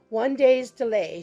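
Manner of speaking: ordinary delivery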